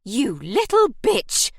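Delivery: slow, loud furious shriek; furious insult. Acted. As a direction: hurl the insult as you lunge. Beasley attacks Nell.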